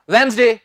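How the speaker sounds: वेडनेसडे as quick wenz-de, short final vowel; abrupt wrong ending. Shown as a demonstration. Teacher shows what not to say, वेडनेसडे with abrupt short de. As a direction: cut वेडनेसडे off at de, no glide. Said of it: "एकदम से मत कहना".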